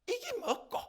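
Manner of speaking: high, scornful Gyeongsang-style sneer; disdainful grumble. Retold or imitated. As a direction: turn your nose up at the food. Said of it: "이러는 거예요".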